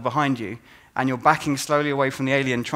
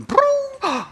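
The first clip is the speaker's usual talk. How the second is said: sharp, very high mock gasp; hammed-up fright gasp; startled gasp; 5 dB louder than usual. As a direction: gasp as if caught. Imitating spotted player.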